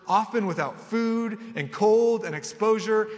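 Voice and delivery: preaching Paul's sufferings to the church, building; preaching scripture. Acted. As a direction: hammer each hardship home. Reads Paul's list with growing force.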